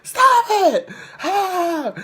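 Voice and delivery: very high squealed shriek; mock screaming. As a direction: imitate a hysterical scream, breaking into squeals. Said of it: "And she was, like, screaming".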